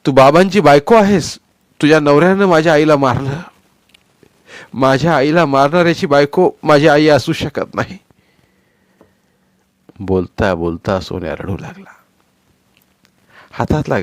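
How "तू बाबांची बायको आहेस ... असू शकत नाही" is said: put-on loud, high, furious child rejecting his stepmother; shouting through tears; angry shout. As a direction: hurl the words at her in rage.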